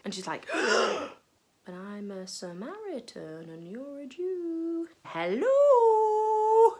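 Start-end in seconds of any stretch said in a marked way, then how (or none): 0.5-4.9 slow, softer, disbelieving; shocked woman. Acted. Shocked woman answers Jesus. 5.0-6.8 high, loud incredulous hello; sarcastic hello. Acted. Woman mocks Jesus' request.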